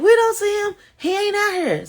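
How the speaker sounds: imitating the officers, high and light; retold police reply. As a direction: lift the voice way up to play the police.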